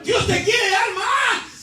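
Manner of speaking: high, slow, drawn-out pulpit cry; fervent preaching. Real, not acted. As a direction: proclaim it with stretched words.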